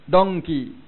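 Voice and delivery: "Donkey" with a hard English D, loud. Shown as a demonstration. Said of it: "Bahasa Inggeris".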